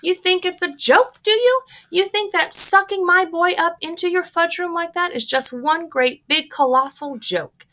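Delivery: very high, loud furious shriek; shrieking mother. Acted. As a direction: hurl the accusation. Mrs Gloop shrieks at Wonka.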